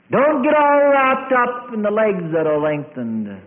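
high, loud sermon cry; impassioned preacher's shout; full-force preaching. Real, not acted. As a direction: proclaim it to the whole congregation.

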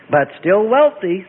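slow, pleased and beaming; old rabbi teasing his rich friend, beaming. A narrator's reading. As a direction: savour it with a broad grin. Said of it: "the rabbi beamed".

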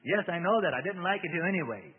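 loud, high, defiant retort. A narrator's reading. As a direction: snap back defiantly. Demon answers Bob defiantly.

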